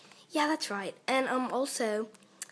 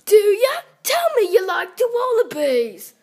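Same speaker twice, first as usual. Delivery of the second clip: very loud, high Australian demand; creepy Australian accent; 6 semitones higher, 11 dB louder than usual. Acted. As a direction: press them hard in an Australian accent. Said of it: "that's a little creepy".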